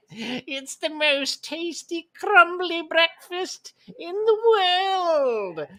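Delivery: high, slow demonic voice. Acted. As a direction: ham it up as a creepy demon host. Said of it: "that voice is too scary".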